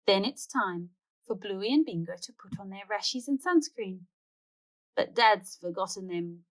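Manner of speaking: plain reading voice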